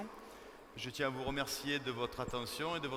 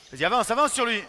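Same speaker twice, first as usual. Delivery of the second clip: shouting instructions; 7 semitones higher, 12 dB louder than usual. Real, not acted. Coach yelling at defender.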